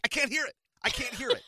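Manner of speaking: loud high-pitched complaint; frustrated shout. Retold or imitated. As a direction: yell it over her phone noise. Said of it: "I'm like".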